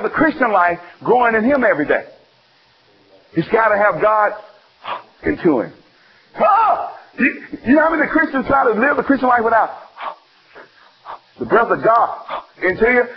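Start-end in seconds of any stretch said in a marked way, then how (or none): 6.4-6.9 ecstatic cry. Real, not acted. Preacher to the congregation, a high ecstatic cry. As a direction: cry out with joy.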